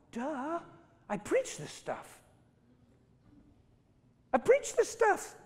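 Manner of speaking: hammed-up goofy self-mockery; mock dopey self. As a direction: play the fool mocking your own slip. Mocking himself as foolish.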